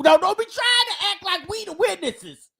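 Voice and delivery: ordinary talk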